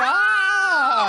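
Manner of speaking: long, high excited "ah"; excited yell. Retold or imitated. Reacting to an exciting moment, excited yell. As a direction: yell out a drawn-out cry of excitement.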